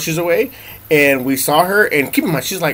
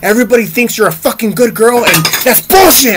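retold yelling; 6 semitones higher, 4 dB louder than usual. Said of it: "at her, like, yelling, like my dad would yell".

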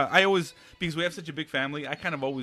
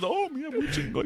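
high, far-off imitation of their dad showing off; mock dad voice; 12 semitones higher than usual. Friend mimicking his dad's bragging, hammed-up high voice. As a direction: ham up dad's proud catchphrase.

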